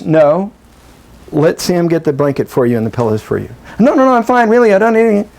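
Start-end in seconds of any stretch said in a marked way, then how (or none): 3.8-5.2 mock protest. Person refusing the sitter's pillows, mock protest. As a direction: brush off the help in a higher voice. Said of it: "practice accepting".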